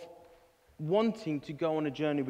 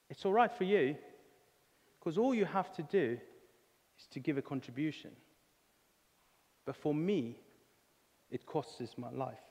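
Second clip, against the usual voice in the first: a hint of a grim pig, lower and softer; a lightly voiced pig, sinking quieter to the end; glum pig; 3 semitones lower, 7 dB quieter than usual. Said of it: "The pig turns around to the chicken and he says".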